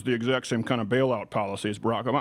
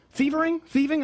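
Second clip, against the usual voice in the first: doubting a word; 12 semitones higher, slower than usual. Real, not acted. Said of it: "I don't even know if they're words".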